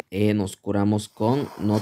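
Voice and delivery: usual speaking voice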